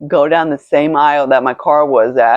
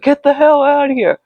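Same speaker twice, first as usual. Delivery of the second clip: high-pitched urgent mom voice; imitated alarmed mother; 10 semitones higher than usual. Mother on the phone ordering daughter out, high urgent imitation.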